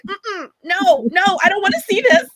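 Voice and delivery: lightly dramatized, high-pitched no; mock refusal. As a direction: act out refusing the sight. Acted refusal of unwanted search results.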